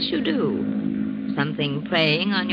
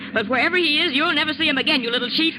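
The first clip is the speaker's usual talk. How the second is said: raised, higher voice spitting venom at a rival; jealous fury; 7 semitones higher, faster than usual. Acted. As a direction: let the hatred burst out as you threaten her. Jealous wife threatens husband's mistress.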